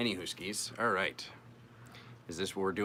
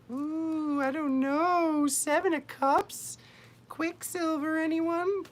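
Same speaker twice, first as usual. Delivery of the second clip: very high, slow put-on voice; silly voice; 3 dB louder, much slower than usual. Reader names the card in a silly high, slow voice. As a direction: float it up in a goofy falsetto. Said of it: "What is that voice?".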